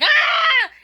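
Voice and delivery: high, very loud falling scream; reenacted scream. Bather falling on the wet floor, loud reenacted scream. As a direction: scream out in terror, acted. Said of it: "I remember the way I screamed".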